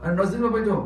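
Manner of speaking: loud, high-pitched order; calling out. Real, not acted. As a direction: call out seating directions to the crowd. Directing people where to sit.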